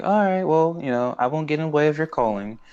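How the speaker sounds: falsely nice, high and sing-song; Azula. Retold or imitated. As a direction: imitate someone being sweetly manipulative. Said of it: "being, like, really nice".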